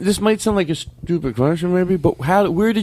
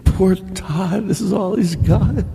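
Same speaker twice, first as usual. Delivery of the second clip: higher, lightly put-on pitying voice; mock pity; 4 semitones higher, slower than usual. Voicing a staring colleague who pities the host, mock sympathy. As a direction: feel sorry for him, hammed up a little. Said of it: "like he feels bad for me".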